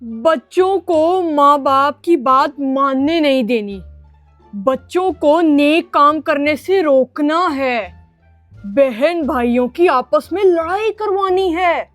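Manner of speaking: slow, drawn-out schoolroom recitation; rote memorizing. Acted. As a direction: recite the lesson aloud by heart, slowly. Pupil memorizing the devil lesson aloud.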